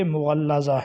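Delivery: مغلظہ as "mu-ghal-laza"; careful "mughallaza" for مغلظہ; correct pronunciation. Shown as a demonstration. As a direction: say مغلظہ with doubled l. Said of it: "جبکہ درست تلفظ جو ہے".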